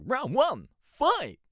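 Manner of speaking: high, loud, upbeat announcer with a sarcastic edge; arcade announcer. Retold or imitated. Streamer imitating the arcade announcer, high and loud. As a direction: bark it like an old fighting-game announcer. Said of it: "upbeat, but almost sarcastic, uh, voice".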